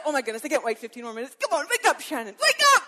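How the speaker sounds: high, loud, fast excited calling; eager wake-up call. Acted. Jesus eagerly calling Shannon awake, high and loud. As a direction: call her up, can't wait any longer.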